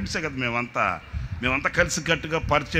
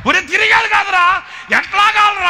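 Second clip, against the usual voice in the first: much higher, louder desperate yelling; panicked shout; 9 dB louder than usual. Retold or imitated. Spinning with the handle, shouting at the friend in panic.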